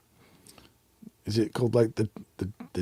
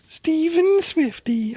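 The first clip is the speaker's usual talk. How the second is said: high drawn-out singsong; much slower than usual. Shown as a demonstration. Name read then sung out.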